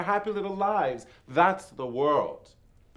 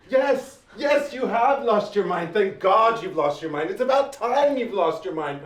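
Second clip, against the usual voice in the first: higher, louder, delighted; gleeful exclaiming; 5 semitones higher, 7 dB louder than usual. Acted. The djinn delights that the woman lost her mind, gleeful. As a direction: celebrate her madness with glee.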